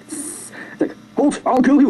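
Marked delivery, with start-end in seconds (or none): 1.2-1.9 higher acted challenge; mock threat. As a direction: challenge the monster in a put-on voice. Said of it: "He's like, he's got a flare".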